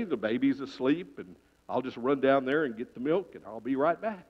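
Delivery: mother's quiet reassuring thought; hushed inner thought. Acted. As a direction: think it quietly.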